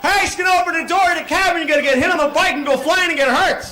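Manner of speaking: high, laughing shouted warning; rushed warning yell. Retold or imitated. Yelling to a cyclist about the cab door, high shouted warning. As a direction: cram the whole warning into one yell. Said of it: "I couldn't go like".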